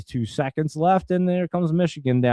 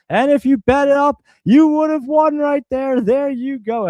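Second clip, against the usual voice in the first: excited; 12 semitones higher, 4 dB louder than usual. Real, not acted. Bet finishes up, cheering.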